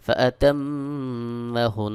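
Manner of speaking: "ফাতাম্মাহুন" drawn out slowly, ending on a held n; vakıf ile durma. Shown as a demonstration. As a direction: chant "ফাতাম্মাহুন" slowly and stop on the long final nasal. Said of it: "যদি আমি এখানে ওয়াকফ করি".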